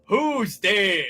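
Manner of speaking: loud angry call into darkness; angry shout. Acted. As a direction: shout it like a suspicious janitor. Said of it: "he shouted angrily".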